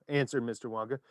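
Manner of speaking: ordinary delivery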